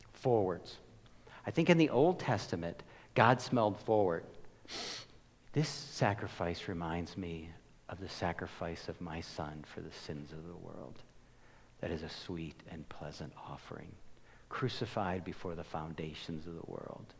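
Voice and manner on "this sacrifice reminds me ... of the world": much softer, lower, hushed and tender; soft voice of God. Acted. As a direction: speak as God, gently, almost under the breath. Pastor voicing God about sacrifice.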